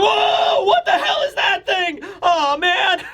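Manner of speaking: high, hammed-up panicked yelp; mock terror. Colin seeing the nightmare creature, comic high-pitched panic. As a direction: play a boy freaking out at a monster. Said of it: "all the children are scared, and Colin as well".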